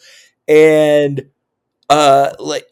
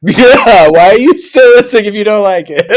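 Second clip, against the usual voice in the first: loud, higher, quick laughing jab; laughing outburst; 5 semitones higher, 7 dB louder than usual. Real, not acted. Co-host riffing on listeners who dislike the show, laughing loudly. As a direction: crack up while firing back loudly. Joking along with co-host's sign-off.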